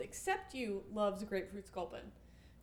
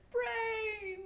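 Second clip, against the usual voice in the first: zombie groaning "Brains!" high and far; zombie moan; 10 semitones higher than usual. Retold or imitated. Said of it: "I picked up that vibe when you said".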